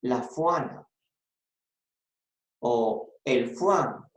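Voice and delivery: "la fuana", Juana said with an f sound for the J; pronúncia da selva. Shown as a demonstration. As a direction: say "fuana" and "fuan" with f, jungle-style. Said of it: "en la selva dicen".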